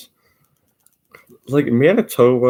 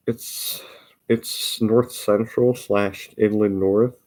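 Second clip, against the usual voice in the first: ordinary talk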